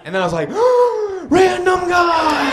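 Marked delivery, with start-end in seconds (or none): 0.5-1.2 sharp, high comic gasp; startled gasp. Acted. Man sees random guy calling, startled gasp. Shocked realisation, acted. 1.3-2.5 high, drawn-out panicked cry; freaked out. Acted. Man sees mystery caller on his phone, freaked out. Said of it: "Now I'm freaked out".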